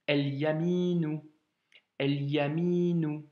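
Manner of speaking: "El yaminu" slow, L sounded; L heard. Shown as a demonstration. Teacher models "el yaminu" with a sounded L. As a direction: read "el yaminu" with the L audible. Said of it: "on doit l'entendre".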